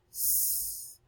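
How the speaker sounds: "s" hissed through teeth; hissing s. Shown as a demonstration. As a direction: hiss "s" like a snake. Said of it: "that sound is s".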